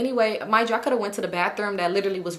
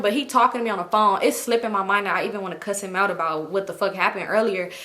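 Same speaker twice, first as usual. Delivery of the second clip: ordinary talk, nothing marked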